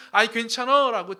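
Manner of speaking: a loud, offhand "never mind"; loud casual dismissal, as if God shrugs it off; loud dismissive brush-off. Retold or imitated. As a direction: wave the offering away loudly. God brushing off David's devotion.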